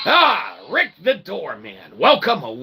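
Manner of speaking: ordinary delivery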